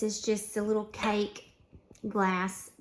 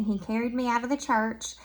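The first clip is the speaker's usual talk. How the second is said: normal talking voice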